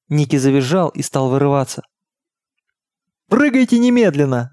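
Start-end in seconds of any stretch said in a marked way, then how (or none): 3.3-4.5 high, urgent yell; yelled order. A narrator's reading. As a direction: shout the command with pitch thrown high. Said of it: "заорал отец Бордену".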